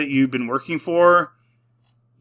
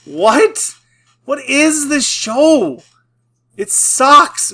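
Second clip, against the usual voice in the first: pitched way up, loud and slow, fed up; exasperated outburst; 10 semitones higher, 5 dB louder, much slower than usual. Real, not acted. To viewers about a show he hates, an exasperated high outburst. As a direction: throw up your hands at the show.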